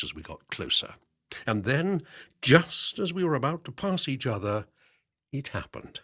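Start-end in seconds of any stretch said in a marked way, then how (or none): none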